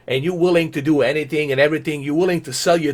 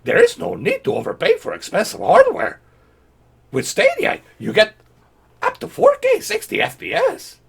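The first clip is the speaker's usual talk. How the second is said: very high squeaky mock Yoda reading. Mocking Google's tweet, squeaky Yoda voice. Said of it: "We gotta do this in a Yoda voice".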